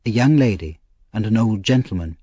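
usual speaking voice

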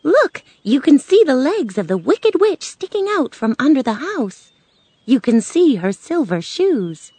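high, animated storybook witch; excited little witch. Acted. Woman shows Dorothy the legs under the house, excited.

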